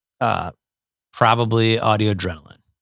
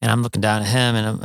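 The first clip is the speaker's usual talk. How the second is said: ordinary delivery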